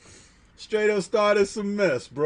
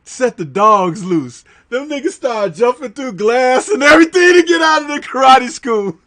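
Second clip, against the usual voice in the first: excited retelling; 6 semitones higher, 8 dB louder than usual. Retold or imitated. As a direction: build up to the punchline. Host retells fight scene excitedly.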